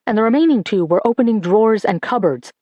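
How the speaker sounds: usual speaking voice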